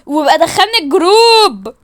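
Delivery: loud, drawn-out announcement; slow it down and blast it out; loud outburst. Acted. Announcing the group to everyone, loud outburst.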